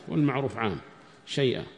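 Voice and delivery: ordinary talk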